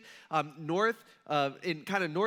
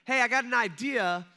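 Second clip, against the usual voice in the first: higher, louder hail; calling out a suggestion; 5 semitones higher, 6 dB louder than usual. Retold or imitated. Stranger on shore offering fishermen advice, calling out. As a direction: pitch the idea out to the boat. Stranger calls to men in boat.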